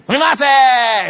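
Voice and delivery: loud, high, slow Japanese call; Japanese call to the chef. Retold or imitated. Pastor imitating calling the sushi chef in Japanese. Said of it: "that kind of thing".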